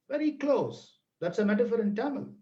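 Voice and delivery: ordinary talk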